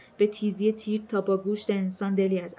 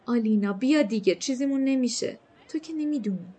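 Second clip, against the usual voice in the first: urgent coaxing; 4 semitones higher than usual. A narrator's reading. Mal coaxing frightened Alina, she objects.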